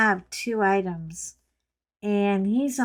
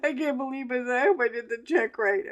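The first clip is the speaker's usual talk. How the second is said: higher, put-on voice of a flustered clerk; mock freak-out; 3 semitones higher, faster than usual. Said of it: "He's freaking out".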